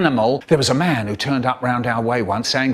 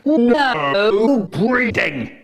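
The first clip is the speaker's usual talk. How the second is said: very slow, higher, stretched-out decree; drawn-out proclamation; 8 semitones higher, much slower than usual. Acted. Standing up front declaring to everyone, very slow drawn decree. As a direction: stretch every syllable like a solemn pronouncement. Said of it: "I stand up front going".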